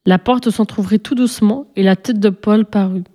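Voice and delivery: ordinary delivery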